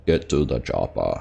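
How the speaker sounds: low, slow Arnie-style catchphrase; Arnie accent. Acted. Streamer doing his best Arnie for viewers, hammed-up accent. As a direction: drop deep and drag it out in an Austrian accent. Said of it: "Arnie accent".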